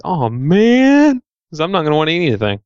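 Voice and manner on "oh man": high, loud letdown "oh man"; dismayed groan. Retold or imitated. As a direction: sigh it out, crushed. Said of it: "I'm like".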